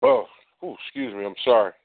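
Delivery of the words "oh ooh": a sudden high choked gasp; catching his breath as emotion hits. Real, not acted. Host on air briefly choking up, high gasp. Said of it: "I got a little choked up right there".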